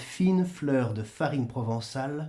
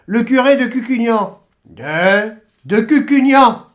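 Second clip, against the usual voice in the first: annonce forte; 6 semitones higher, 9 dB louder than usual. Acted. Le curé s'annonce à la porte.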